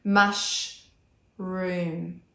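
Mushroom drawn out long on the r; stretched r. Shown as a demonstration. Therapist shows the r in the second syllable, long and slow. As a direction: slowly hold the r in Mushroom. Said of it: "the R in the second syllable".

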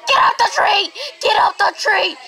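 high, loud frustrated shouting at the game; frustrated yell. Real, not acted. Gamer stuck under the tree, frustrated high yell. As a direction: yell at the squirrel to climb.

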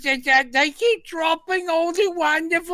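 very high, screechy Python-style drag falsetto; shrieking Pepper Pot voice; scroungy Cockney cleaning lady. Retold or imitated. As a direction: squawk like a man playing an old charlady. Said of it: "Monty Python in drag sketch".

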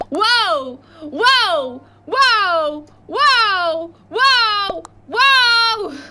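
rising alarmed whoa; building whoa. Real, not acted.

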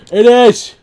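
sudden loud, high yelp; startled shout. Real, not acted. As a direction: cry out as the trap springs. Trap chest springs on player.

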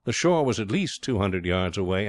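ordinary delivery, nothing marked

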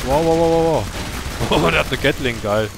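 loud, amazed wow wow; excited. Real, not acted. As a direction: staunend und laut rufen. Excited at new weapons. (begeistert staunend)

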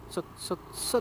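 whispering. Real, not acted. Weaving through traffic.

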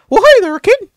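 high squeaky cartoon mouse voice; Mickey Mouse. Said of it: "practicing my Mickey impression".

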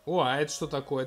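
ordinary delivery

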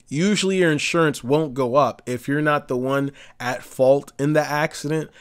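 ordinary talk, nothing marked

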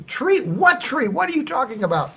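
high-pitched alarmed confusion; startled wife. Retold or imitated. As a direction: voice the wife jolted and baffled.

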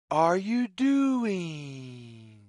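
ordinary delivery, nothing marked

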